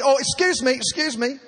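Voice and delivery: acted high-pitched "yoo-hoo" politeness; calling for attention. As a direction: hail an attendant across the cabin.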